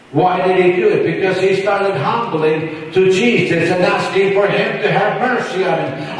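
high, fervent preaching, pushing hard; preaching at full force. Real, not acted. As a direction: preach it out to the congregation.

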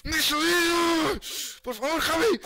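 high, loud, drawn-out yell; screamed plea. Real, not acted. Scared player begging Javi, screamed plea. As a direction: scream and beg in alarm.

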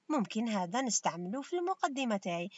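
ordinary talk

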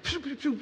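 high, soft whooshing lashes; imitated rope swishes. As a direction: mimic a rope whipping through the air. Imitates the rope swishing through air.

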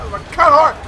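loud angry yell at the horse; yelling at enemy. Real, not acted. Gamer yelling at a horse enemy, loud. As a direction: shout it at the enemy. Yelling at boss mid-fight.

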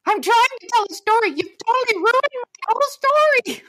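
high-pitched indignant whine; acted exasperated outburst. Storyteller to ladies who keep interrupting, exasperated outburst. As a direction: protest in a high, held-up voice.

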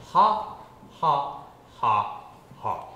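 crisp aspirated ha, ha; breathy ha drill. Shown as a demonstration. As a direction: breathe out each ha. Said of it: "Do this for me".

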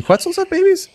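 bright excited curiosity; eager question. Real, not acted. Excited question about baby dinos.

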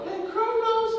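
high sweet call of a name; flirty woman's call. Acted. Rhea calling Kronos over, high and flirty. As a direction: coo it like a flirt. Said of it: "Rhea called me over".

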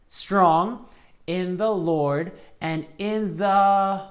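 drawn-out verse reading; very slow recitation. Shown as a demonstration. Teacher slowly reciting the verse. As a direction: stretch every word. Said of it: "Let's try it one more time".

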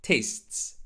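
TASTES in one syllable, "taysts"; correct tastes. Shown as a demonstration. Teacher modelling tastes, just an s added at end. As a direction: finish "tastes" on a crisp STS cluster. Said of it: "It's like this".

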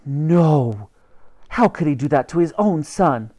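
mildly acted, emphatic shock; appalled outcry. Queen learns father sent her son here, appalled outcry. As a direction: protest it with a touch of outrage.

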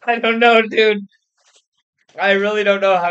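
loud and high, still laughing; loud laughing reply. Real, not acted. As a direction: answer through a big laugh. Laughing reaction after story beat.

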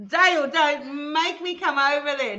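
high, loud laughing warning; laughing mock threat. Real, not acted. Laughing mock threat to Dale in chat. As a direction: threaten playfully through laughter.

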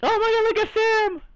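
gushing over a favourite; excited squeal. Real, not acted. Fan spotting Sam on screen, excited high gush.

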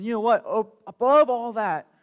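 lightly voiced God bursting out with good news; God declaring mercy.

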